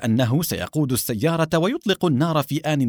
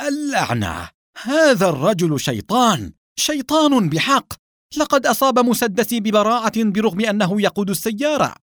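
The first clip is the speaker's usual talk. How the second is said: indignant cursing; 8 semitones higher, 3 dB louder than usual. A narrator's reading. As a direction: curse bitterly with pitch rising high. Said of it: "وهو يسب ساخطا". (سباب ساخط بنبرة مرتفعة)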